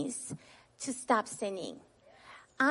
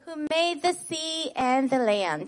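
put-on call-out for children to repeat; 3 semitones higher than usual. Shown as a demonstration. Teacher calling the verse for children to echo back. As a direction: lead the kids' recitation, calling each phrase out. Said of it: "we're gonna say the Bible verse together".

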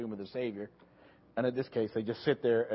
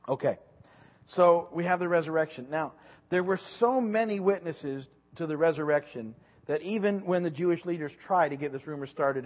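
ordinary talk

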